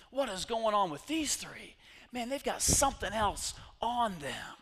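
loud, high, building preacher's cry; preaching at full force. Acted. Preacher to congregation, rising to a shout. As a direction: drive it home to the congregation.

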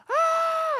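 piercingly high, loud scream; imitated freak-out scream. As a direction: let out a sudden mock shriek. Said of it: "she freaks out".

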